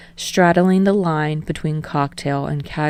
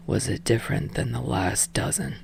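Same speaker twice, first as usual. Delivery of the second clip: blunt; 5 semitones lower, 9 dB quieter than usual. A narrator's reading. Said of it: "he asks blatantly".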